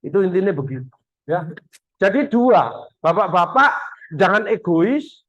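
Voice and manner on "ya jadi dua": ordinary talk, nothing marked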